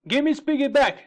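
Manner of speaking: high, loud demanding cry. Acted. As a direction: yell it out, desperate to get the toy back. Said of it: "cried Rick".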